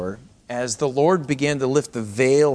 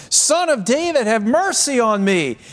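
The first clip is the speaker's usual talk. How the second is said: very high, raised cry for mercy; crying out all the more; 9 semitones higher, 4 dB louder than usual. Retold or imitated. Blind beggar persisting over a noisy crowd, crying out harder. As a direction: call out insistently over the crowd, pitched high. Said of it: "he cried out all the more".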